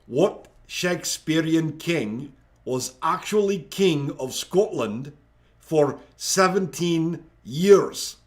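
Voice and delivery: slow, measured Scottish accent; hammed-up Scottish reading. Shown as a demonstration. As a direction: read it in a put-on Scottish voice, drawn out. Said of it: "I have to do that in a Scottish voice".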